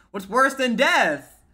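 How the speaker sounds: high, drawn-out, loud disbelief; loud bafflement. Real, not acted. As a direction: ask it loudly, stretching the words. Baffled by the line just read.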